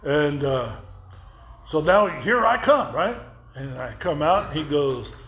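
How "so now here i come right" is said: ordinary talk, nothing marked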